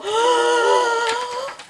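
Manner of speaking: long, high-pitched intake of breath; deep gasp. Acted. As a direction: suck in a huge startled breath. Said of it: "He's taking a deep breath".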